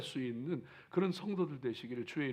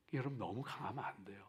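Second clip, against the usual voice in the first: quiet aside; 5 semitones lower, 9 dB quieter, faster than usual. Shown as a demonstration. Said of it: "repeated soft".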